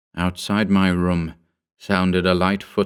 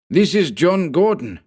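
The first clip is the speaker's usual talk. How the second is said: high, hissing fanatic's voice; hissed; 10 semitones higher than usual. Acted. Henchman hissing a warning over the fallen man. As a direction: hiss it like a cornered henchman. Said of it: "he hissed".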